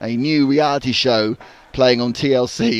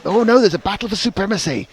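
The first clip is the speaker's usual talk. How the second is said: rising excitement, higher and louder; excited; 8 semitones higher, 3 dB louder than usual. Acted. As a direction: burst out at the battle. Excited climax of commentary.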